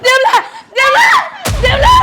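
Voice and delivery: high, loud frantic calling; frantic shout. Acted. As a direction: yell his name to stop him. Mother shouting son's name. (દીકરાને રોકવા માટે જોરથી બૂમ)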